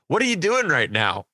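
put-on kid asking. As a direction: mimic a curious kid, amused. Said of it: "one kid goes".

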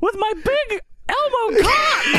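very high cartoon brag; boastful Elmo voice. Elmo bragging to Sonny, high squeaky voice. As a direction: squeal it as a cocky puppet.

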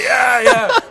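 loud, high surfer agreement; imitated excited yelping; eager agreement. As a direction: agree like an over-keen dude.

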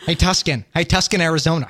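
"Tuscan" as TUSS-kin, for Tucson; joke mispronunciation. Pretend. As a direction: needle them with a botched place name. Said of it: "Tucson if it was anything".

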